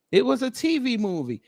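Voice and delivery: can't believe it was a "T V movie"; amused disbelief. Real, not acted.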